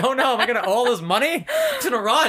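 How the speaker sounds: high laughing fake dread; mock panic through laughter. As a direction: ham up the fear, laughing. Retelling friend's unemployment panic.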